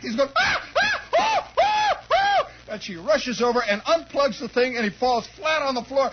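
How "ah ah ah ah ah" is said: high-pitched repeated yelps; hammed-up screams, very high; mock shock screams. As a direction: imitate a kid faking electrocution. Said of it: "he puts on his act".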